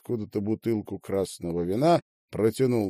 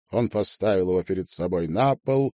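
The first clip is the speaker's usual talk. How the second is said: plain reading voice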